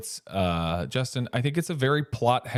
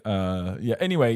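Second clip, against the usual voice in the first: ordinary delivery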